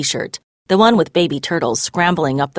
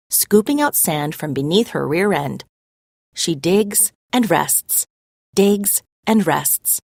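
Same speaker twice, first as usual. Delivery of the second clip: usual speaking voice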